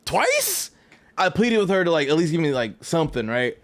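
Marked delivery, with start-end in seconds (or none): 0.1-0.7 Twice? jumps way up in pitch, drawn out in disbelief; incredulous high squeal. Retold or imitated. To the airline rep after a second refund denial, squealed disbelief. Outraged echo of his own word.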